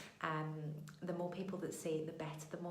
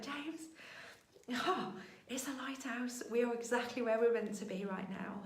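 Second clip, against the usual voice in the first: retold crying; 5 semitones higher, 3 dB louder than usual. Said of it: "And I burst out crying".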